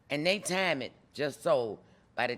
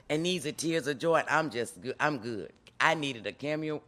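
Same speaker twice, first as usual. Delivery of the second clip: normal talking voice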